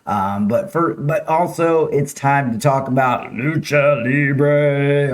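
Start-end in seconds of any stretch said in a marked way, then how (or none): 3.3-5.1 slow, drawn-out wrestling announcer. Acted. Host hyping the weekend's Lucha Libre, wrestling voice. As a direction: stretch it out like a ring announcer. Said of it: "Am I gonna do it in my, uh, wrestling voice".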